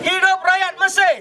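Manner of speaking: high, loud rally chant; fiery revolutionary speech. Real, not acted. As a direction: chant it out to the crowd. Leads protest chant call.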